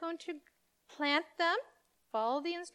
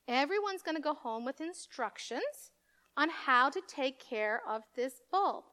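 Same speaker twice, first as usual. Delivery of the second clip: ordinary talk, nothing marked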